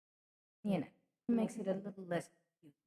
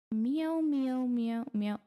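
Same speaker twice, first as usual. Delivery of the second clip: loud, repeated mock meows; cat meowing; 3 semitones higher, 6 dB louder, much slower than usual. Meowing back at a cat in the chat, playful cat voice. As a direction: call out like a cat, drawn out.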